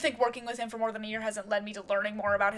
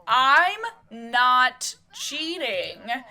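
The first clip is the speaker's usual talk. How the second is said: "I'm not cheating." very slow, every word hit loud; emphatic denial; 3 semitones higher, 7 dB louder, much slower than usual. Acted. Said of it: "almost laughing at the situation before emphasizing".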